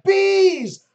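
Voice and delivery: high, loud mock-panic yell; panicked yelling. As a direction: yell out as if under attack, hammed up. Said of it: "you started yelling".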